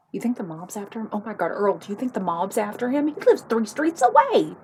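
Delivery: building to a high, loud panic; mounting alarm. Acted. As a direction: work yourself up into hammed-up fright. Wife alarms herself about the mob.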